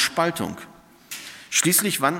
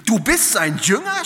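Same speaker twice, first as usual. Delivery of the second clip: higher, louder, slower, an outraged cry; shouted accusation; 10 semitones higher, 4 dB louder than usual. Acted. Pharisees shouting at the healed man, outraged. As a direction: hurl the question at him in disbelief. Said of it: "schrien sie ihn an".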